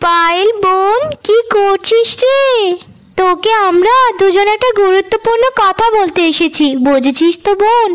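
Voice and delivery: scheming elder sister. Acted. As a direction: sweet-talk the little sister into a trap.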